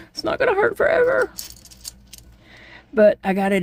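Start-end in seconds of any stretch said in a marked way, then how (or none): 0.1-1.3 much higher, quick, strained pep talk; strained self-talk. Retold or imitated. Telling herself through pain, high and strained. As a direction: squeak it out to get through the pain. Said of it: "I had to tell myself that a hundred times".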